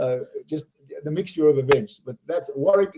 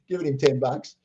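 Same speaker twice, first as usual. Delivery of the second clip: plain reading voice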